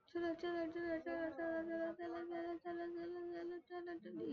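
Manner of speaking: very soft, repetitive chant; soft urging. Real, not acted. Gamer coaxing the vehicle onward, soft repeated urging. Urging himself through tricky spot.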